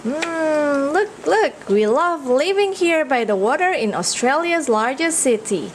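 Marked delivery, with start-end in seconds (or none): none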